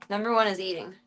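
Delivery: ordinary talk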